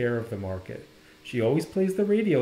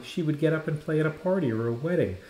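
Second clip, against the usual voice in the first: usual speaking voice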